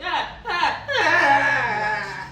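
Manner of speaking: high, loud laughing cry; horrified laughing wail. Retold or imitated. Girl recalls the shock at home, laughing wail. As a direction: wail in mock horror through laughter. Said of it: "I was just like".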